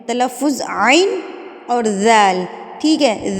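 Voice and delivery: ordinary talk, nothing marked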